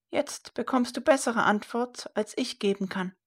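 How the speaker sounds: put-on high, hesitant old mother's voice; evasive old woman. A narrator's reading. Old mother avoiding her son's request, high and evasive.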